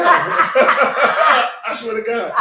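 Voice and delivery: high, loud laugh through the words; helpless laughter breaking up the line. Real, not acted. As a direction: swear it while cracking up. Laughs while swearing story is true.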